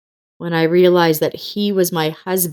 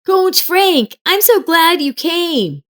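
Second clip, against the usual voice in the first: put-on eager young boy; 11 semitones higher, slower than usual. Boy greets coach.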